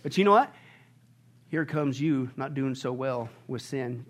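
ordinary talk, nothing marked

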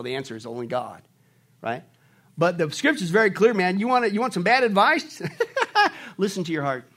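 usual speaking voice

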